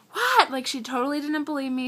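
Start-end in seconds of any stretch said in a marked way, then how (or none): 0.1-0.5 high, loud shocked "What?". Retold or imitated. Mom hearing the lap band news, shocked high cry. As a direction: jolt up into a disbelieving cry. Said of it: "And she's like".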